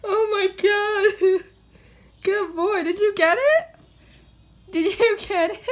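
high, loud laughing pet talk. Real, not acted. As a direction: giggle through the words. Laughing at cat.

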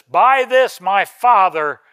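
big, measured preaching voice; emphatic scripture proclamation. Acted. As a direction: proclaim each word slowly and loudly to the congregation.

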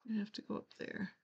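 ordinary delivery

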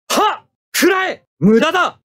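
high, forceful attack shouts; battle cries. Acted. Pilot attacking the enemy mobile suit, high forceful shouts. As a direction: yell them as each shot goes out.

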